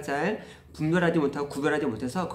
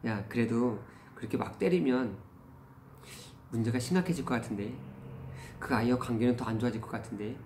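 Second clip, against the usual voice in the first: softer, lower fatherly caution; retold tentative, low-key advice to a child; casual gentle probing; 4 semitones lower, 7 dB quieter than usual. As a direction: slip in a careful word of doubt. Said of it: "아이에게 슬쩍 얘기한".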